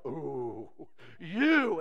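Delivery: low drawn-out ooh, then a lifted 'you'; swooning admirer; awed worship. Acted. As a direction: coo in awe, rising onto the last word. Voices worshippers seeing God.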